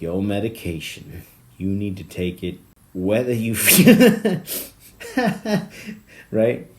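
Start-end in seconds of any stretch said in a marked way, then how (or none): 2.9-6.7 words dissolving into a loud laugh; breaking into laughter. Real, not acted. Comedian losing it at his own medication bit, laughing. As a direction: crack up halfway through.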